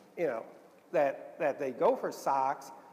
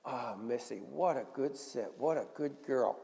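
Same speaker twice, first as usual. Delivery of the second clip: praising the dog; 5 semitones lower, 5 dB quieter than usual. Shown as a demonstration. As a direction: praise Missy gently as a reward. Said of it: "you say".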